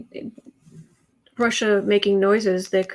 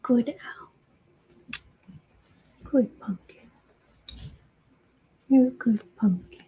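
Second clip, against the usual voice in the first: soothing whisper. Real, not acted. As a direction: whisper gently to calm a scared pet.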